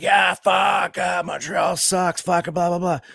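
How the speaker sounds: high mocking shout of a gloating fan; mock fan yelling. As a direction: bellow it like a gloating hockey fan. Mocking a loud Leafs fan.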